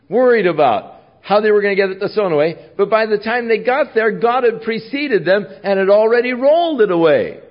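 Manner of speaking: high, loud, rising sermon; fervent preaching. Acted. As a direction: preach it with full fire. Preaching at full force.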